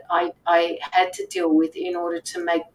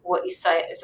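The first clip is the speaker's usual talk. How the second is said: ordinary delivery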